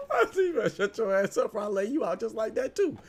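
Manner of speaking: high, loud retold threat through laughter; laughing. Real, not acted. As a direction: crack up while repeating your threat. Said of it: "I'm laughing now".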